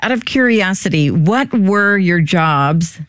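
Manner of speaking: ordinary talk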